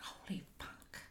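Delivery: barely voiced, stunned whisper; shocked whisper. Speaker to herself right after sharing, stunned whisper. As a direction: whisper it to yourself in dread.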